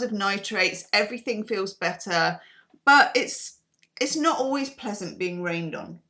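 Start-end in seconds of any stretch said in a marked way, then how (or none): none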